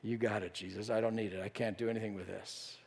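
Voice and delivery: disciple's quiet relief handing the food to Jesus; relieved. Retold or imitated. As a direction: let the burden go with a soft, low sigh of relief. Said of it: "wave of relief".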